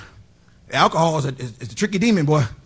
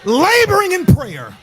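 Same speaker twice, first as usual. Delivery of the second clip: sermon peak, higher and higher; building preaching climax; 6 semitones higher than usual. Acted. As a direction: climb and call it out to the church. Preaching climax rising high.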